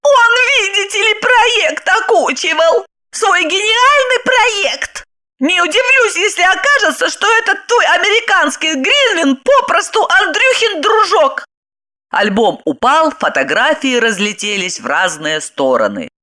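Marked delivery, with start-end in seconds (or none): 0.0-11.5 high, slowed, sarcastic jeer; mock his precious project; spiteful mockery. Wife jeers at husband's project, high spiteful sarcasm. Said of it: "зло швырнув альбом".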